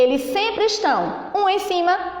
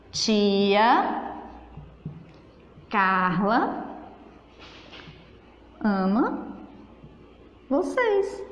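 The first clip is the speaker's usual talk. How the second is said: slow plain statement; 4 semitones lower, 5 dB quieter, much slower than usual. Shown as a demonstration. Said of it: "eu apenas afirmei".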